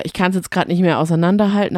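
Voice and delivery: ordinary talk, nothing marked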